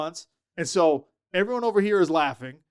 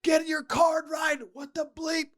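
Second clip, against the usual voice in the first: high, furious shout across the field; freaking out yelling; 10 semitones higher, slower than usual. Retold or imitated. Assistant coach yelling at the scout team about the wrong card, high furious shout. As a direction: bark it at the confused walk-on like a frantic assistant. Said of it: "is freaking out".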